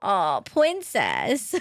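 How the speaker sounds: slow, drawn-out coo of princess; cooing at pet. Real, not acted. Streamer coos slowly to her pet princess, tender. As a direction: melt over the pet, stretch the words.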